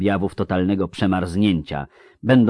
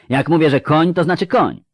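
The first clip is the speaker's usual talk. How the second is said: high, loud, insistent retort; indignant yell; 10 semitones higher, 7 dB louder than usual. A narrator's reading. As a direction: insist angrily, brooking no doubt. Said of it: "wrzasnęła Anguiem". (oburzone, głośne upieranie się)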